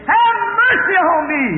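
high, drawn-out plea for mercy; crying out. Acted. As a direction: call out to Jesus as he passes, pitched high. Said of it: "his screaming stopped him".

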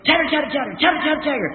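high-pitched chattering squirrel imitation. Squirrel on a stump chattering at the hunter, imitated.

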